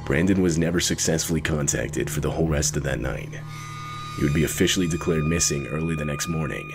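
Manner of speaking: ordinary talk, nothing marked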